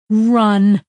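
sharp shouted command; urgent shout. A narrator's reading. Jane sixty-four shouting a warning, urgent shout. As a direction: yell it as a warning. Remembered warning in her head.

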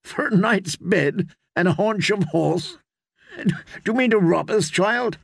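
incredulous knight, pitched high; laughing disbelief. A narrator's reading. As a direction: scoff at the child's price. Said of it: "Sir Hyle laughed".

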